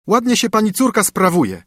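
nanny rudely confronting the mother; loud rude servant accusing; brutal scolding. Acted. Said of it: "brutalnie zwróciła się do mamy".

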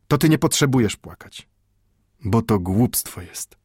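insistent, tender grandfather's admonition; with emphasis. Acted. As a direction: press every word home to the boy. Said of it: "rzekł z naciskiem".

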